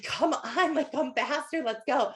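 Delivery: lightly voiced husband hurrying her along; husband urging.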